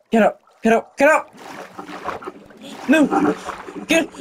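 usual speaking voice

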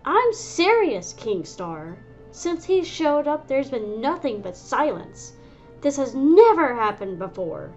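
insist, worked up and loud; riled up. Acted. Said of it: "riled up".

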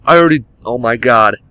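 normal talking voice

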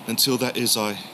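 normal talking voice

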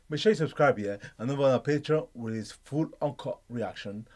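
ordinary delivery

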